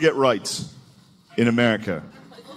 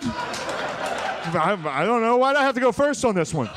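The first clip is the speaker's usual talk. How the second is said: high, stammering mock complaint; flustered first congressman; 8 semitones higher, slower than usual. First congressman defending himself to the others, high flustered protest. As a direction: whine in a high put-on voice, on the defensive. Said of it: "some guy coming up first".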